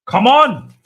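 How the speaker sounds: loud, high shout of frustration; frustrated yell. Real, not acted. Fan yelling at his team on screen, a loud frustrated shout. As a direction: bellow it at the screen.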